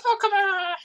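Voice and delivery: high squeaky protest from a goblin; annoyed goblin. Acted. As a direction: yelp it like a goblin getting punched. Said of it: "and the goblin goes".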